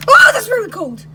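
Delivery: sudden high, loud outburst; shocked yelp. Acted. Mario making a bed in the fridge, shocked yelp. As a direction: yelp at a freezing touch. Lies down on cold surface.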